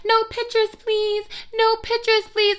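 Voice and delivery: put-on high little-girl voice asking for no pictures; North West impression. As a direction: squeak it like a small child. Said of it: "he sounds like Northwest".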